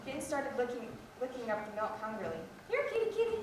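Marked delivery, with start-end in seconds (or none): 2.7-3.4 high sing-song call to a cat. Acted. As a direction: call the cat over across the yard. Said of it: "the farmer's wife called".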